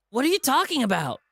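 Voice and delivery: high, loud, frightened question; panicked disbelief. Acted. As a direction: back away in alarm as you ask. TJ panics at the confession.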